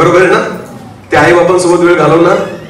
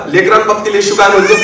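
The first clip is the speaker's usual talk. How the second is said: usual speaking voice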